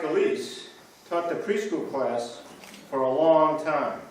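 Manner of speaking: ordinary delivery, nothing marked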